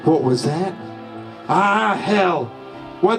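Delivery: ordinary talk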